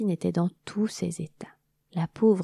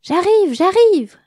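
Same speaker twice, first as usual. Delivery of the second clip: calling back; 11 semitones higher, 11 dB louder, slower than usual. Acted. Queen answering Églantine's calls.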